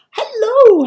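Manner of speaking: very high, loud hello; gushing welcome to a viewer; excited hello. Real, not acted. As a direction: sing out a delighted hello. Greeting a viewer.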